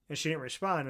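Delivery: ordinary talk, nothing marked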